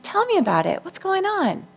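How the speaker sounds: soft, caring parent asking, rising on the question; gentle parent voice. Retold or imitated. As her own parent, answering her angry little self tenderly. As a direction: coax it out kindly, like a mother. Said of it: "I'm my own parent".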